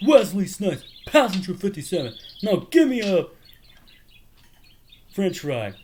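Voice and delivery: hammed-up tough-guy taunt; movie villain quote. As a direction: taunt like a thug demanding fries. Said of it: "He was like".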